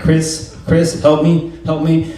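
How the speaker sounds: mock panic. Stuck under the bar, calling his spotter in mock panic. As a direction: beg the spotter to save you. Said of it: "Now I'm panicking".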